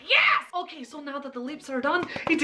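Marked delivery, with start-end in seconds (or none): 0.1-0.4 high excited cheer; a bright little victory yes. Real, not acted. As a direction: burst out happily.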